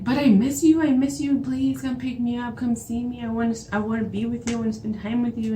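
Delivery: high, whiny-pleading boyfriend imitation; imitated pleading. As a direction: mimic a clingy ex begging on the phone. Boyfriend begging to be picked up.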